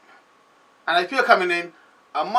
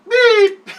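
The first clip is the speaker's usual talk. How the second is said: mock censor beep; 10 dB louder than usual. Censoring a curse word himself.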